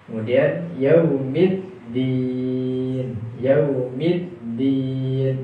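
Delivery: yaumiddin with a slow held double d; doubled dal. Shown as a demonstration. Teacher drilling the stressed dal. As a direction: lean on the dd of yaumiddin. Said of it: "Yang tasydid adalah huruf dal".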